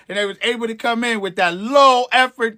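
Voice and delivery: ordinary talk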